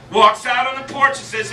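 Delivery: ordinary delivery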